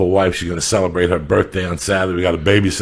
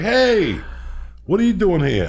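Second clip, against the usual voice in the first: retold challenge; 10 semitones higher, 4 dB louder than usual. Art catching an intruder outside his office, high challenging call. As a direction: call out a raised, accusing question.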